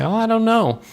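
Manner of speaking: mock-innocent falsetto shrug; coy high answer. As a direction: play innocent in a squeaky mock voice. Player coyly answering the villain.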